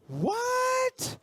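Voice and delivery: high, startled exclamation; sudden shock; surprised. Real, not acted. Surprise news of second set.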